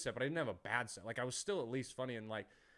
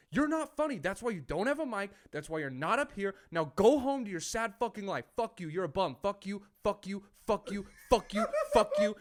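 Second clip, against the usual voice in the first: raised, relentless angry rant; retold freak-out; 6 semitones higher, 6 dB louder than usual. Comic berates heckler until he's removed, raised retold anger. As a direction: re-enact losing it, building to repeated curses. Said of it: "had a little freak out on stage".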